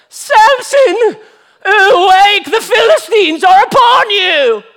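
high, loud, drawn-out British cry; hammed-up British lady raising the alarm; British accent. Delilah waking Samson to warn him, shrieked British accent. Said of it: "I gave her a British accent".

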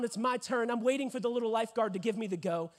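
normal talking voice

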